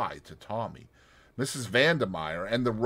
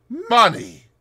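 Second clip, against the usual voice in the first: loud, high scorn on Money!; disgusted outburst; 13 semitones higher, 11 dB louder than usual. Acted. Boris disgusted.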